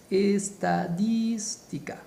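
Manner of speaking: "Estadística" very slowly, stretched syllable by syllable; slow syllabic demo. Shown as a demonstration. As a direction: draw out each part of "Estadística" for students. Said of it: "¿Cómo se separa estadística?".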